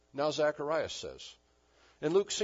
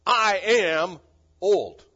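put-on "I am" very loud and raised, then a pause before "old"; emphatic I AM; 5 semitones higher, 13 dB louder, much slower than usual. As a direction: hammer "I am", then land "old" separately. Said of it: "Zechariah said with emphasis".

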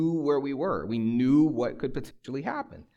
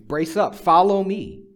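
loud, high rallying order; commanding shout with an exclamation point; emphatic command; 7 semitones higher, 9 dB louder, slower than usual. Acted. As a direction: urge them on with full force. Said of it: "It's emphatic. He's saying".

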